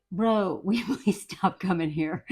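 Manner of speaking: low, quiet weary request; doctor. Retold or imitated. Doctor pleading with the husband, low mock-weary murmur. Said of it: "this doctor's like".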